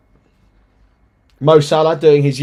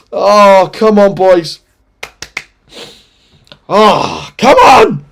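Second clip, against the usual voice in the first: desperate pleading; 6 semitones higher, 7 dB louder, much slower than usual. Real, not acted. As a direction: beg the team, loud and strained. Nervous fan before kickoff.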